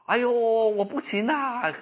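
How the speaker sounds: high, slow, drawn-out lament; tearful wail. Retold or imitated. Preacher acts out patient crying on the phone, tearful wail. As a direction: imitate someone wailing in despair. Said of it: "人家打电话就哭".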